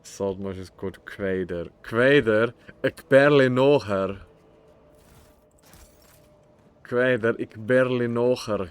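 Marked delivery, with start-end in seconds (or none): 1.8-8.7 hammy fake German accent. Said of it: "I speak the funny fake German language".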